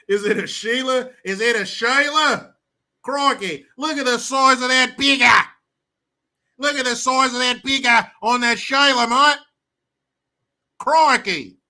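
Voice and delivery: mock Crocodile Hunter Aussie; over-the-top Australian bloke; Australian accent. Reading chat in Aussie accent.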